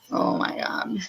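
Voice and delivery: breathless whispered oh my God; whispered aside. Real, not acted. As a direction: drop to a whisper. Quiet aside while reading chat.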